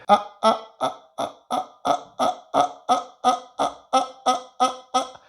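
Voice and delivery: short soft vocal fry ahs; staccato ahs with vocal fry. Shown as a demonstration. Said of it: "incorporate this sound into the staccato".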